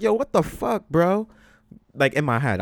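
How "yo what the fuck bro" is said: high, loud outraged reaction; loud incredulous yell; yelled disbelief. Retold or imitated. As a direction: burst out with it, appalled. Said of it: "of course, I didn't yell".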